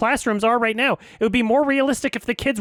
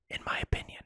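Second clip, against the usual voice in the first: whispered under the breath; hushed sarcastic aside; 14 dB quieter, faster than usual. Real, not acted. Quiet aside.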